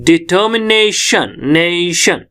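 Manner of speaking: "Determination" with a drawn-out, forceful "ने"; stress on NE. Shown as a demonstration. Teacher shows the compression point, stressed long "ने" in "determination". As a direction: punch and lengthen "ने" in "determination" and "nation". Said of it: "हम जोर से बोल रहे हैं".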